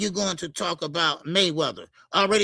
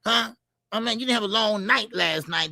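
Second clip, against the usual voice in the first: usual speaking voice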